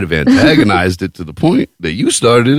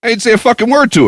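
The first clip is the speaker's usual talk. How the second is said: indignant; faster than usual. Real, not acted. Indignant retelling.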